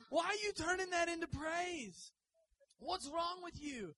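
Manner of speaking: high, loud mock scolding; hammed-up nagging wife. Job's wife scolding her husband, hammed-up loud nagging. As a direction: nag him loudly in the wife's voice. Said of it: "what his wife said".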